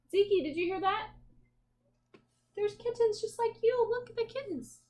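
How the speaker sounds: light up and coo at the kittens; excited. Real, not acted. Mother pointing out kittens to little Zeke, excited and high.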